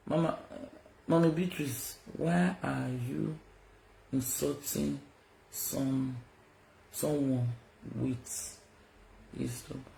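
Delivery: very soft, slow, fake-innocent voice; mock meek murmur. Mocking a rival named Beatrice in a soft, fake-innocent murmur. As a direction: pretend to plead sweetly.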